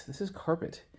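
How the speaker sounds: sing-song teacher explaining; kindergarten teacher voice. Shown as a demonstration. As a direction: say "This is carpet" like to small kids. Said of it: "I sound like a kindergarten teacher".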